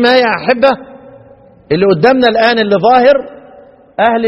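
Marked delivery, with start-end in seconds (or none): none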